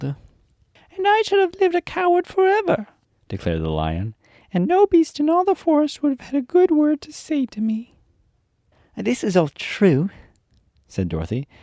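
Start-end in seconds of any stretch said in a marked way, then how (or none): 0.0-2.9 a much higher put-on voice for the big shaggy lion; high, louder lion character voice; the Lion's voice. 4.5-8.0 a much higher put-on voice for the big shaggy lion; high, louder lion character voice; the Lion's voice. 9.0-10.1 put-on a higher, little girl voice for Dorothy; higher storybook girl voice; Dorothy's voice.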